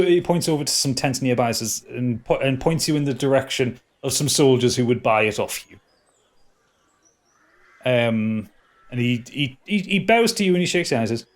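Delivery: normal talking voice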